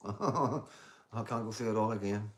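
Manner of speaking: slow, weary-joking laugh through the words. Real, not acted. Streamer begging off retelling drama to a viewer, laughing. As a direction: laugh as you beg off retelling it.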